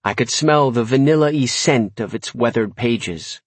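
usual speaking voice

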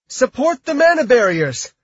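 loud, high yelled order; yelling. A narrator's reading. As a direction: yell the command over the battle. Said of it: "Kim yelled".

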